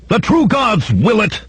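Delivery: high-pitched fervent yell; yelled war cry. A narrator's reading. Hillman charging at Conan, high yelled war cry. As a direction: yell it as a holy battle cry. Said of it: "he yelled".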